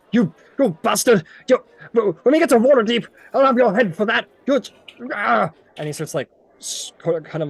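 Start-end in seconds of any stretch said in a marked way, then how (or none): 0.1-5.5 loud, high sputtering outburst; enraged yelling. Acted. Enraged merchant to the one who held him, sputtering yell. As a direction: yell in blustering fury, tripping over words. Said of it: "like, yelling".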